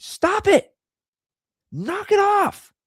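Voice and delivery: high-pitched parental yell, re-enacted. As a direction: snap sharply at a child to quit. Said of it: "I just, I yelled at her".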